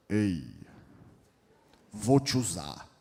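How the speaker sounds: deep booming God voice. Pretend. God calling Moses from the burning bush, deep and booming.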